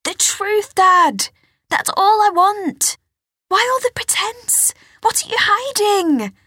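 high, loud angry demand; frustrated outburst. Acted. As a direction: near foot-stamping fury, high. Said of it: "She almost stamped her foot".

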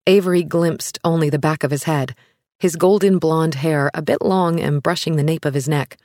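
usual speaking voice

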